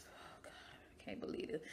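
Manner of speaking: breathless whisper under her breath; panicked whisper. Retold or imitated. On the table, she panics silently to herself, whispering.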